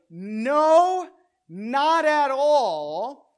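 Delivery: loud, drawn-out emphatic refusal. Retold or imitated. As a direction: raise pitch and volume, stretching NO and NOT AT ALL. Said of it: "is emphatically".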